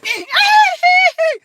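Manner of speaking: a sudden high burst of laughter; loud high cackle. Real, not acted. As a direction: crack up loudly at the joke. Laughing at his own image.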